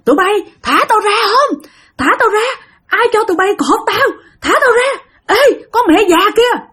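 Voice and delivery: high-pitched mock ranting; retold high, agitated hollering; imitated cursing yell. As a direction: act out a bound man railing at his captors. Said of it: "ba chửi nha".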